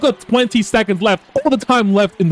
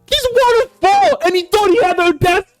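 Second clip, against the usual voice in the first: much higher, disbelieving mock outrage; incredulous rant; 10 semitones higher than usual. Real, not acted. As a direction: rant in disbelief at a hopeless shot. Ranting at player's half-court shot.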